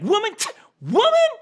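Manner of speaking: high, loud incredulous "Woman?"; rising in mock disbelief; a hammed-up offended "woman?". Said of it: "It's like".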